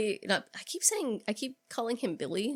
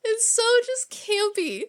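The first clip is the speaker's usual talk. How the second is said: laughing gush; 12 semitones higher, 4 dB louder, slower than usual. Real, not acted. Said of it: "gushing laugh".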